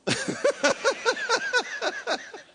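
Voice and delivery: loud high falling laugh. Real, not acted. Preacher cracking up before the congregation, loud. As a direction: let a big laugh roll down.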